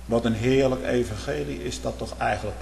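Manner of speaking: ordinary talk, nothing marked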